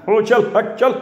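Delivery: high sharp calls to the oxen; calling to bullocks. A narrator's reading. Bapu urges his bullocks forward, high calls. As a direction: urge the bullocks on.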